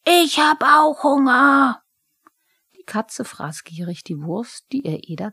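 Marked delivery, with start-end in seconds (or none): 0.1-1.8 much higher, loud, slow kobold bellow. A narrator's reading. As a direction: roar it from the kitchen. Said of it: "brüllte".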